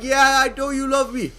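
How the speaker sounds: put-on cocky hype kid. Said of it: "I'm like".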